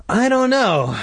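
high, worried mother; fretful. A narrator's reading. Mom unsure about the park, fretful. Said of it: "she said fretfully".

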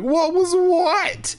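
goofy scared cartoon-dog drawl; Scooby-Doo voice. [name] answers his friend, hammed-up cartoon-dog whine. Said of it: "in true Scooby-Doo fashion".

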